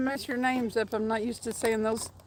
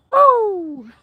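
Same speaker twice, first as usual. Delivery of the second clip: surprised shout; 8 semitones higher, 12 dB louder than usual. Real, not acted. Sudden fish or strike reaction.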